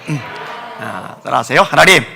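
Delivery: ordinary talk, nothing marked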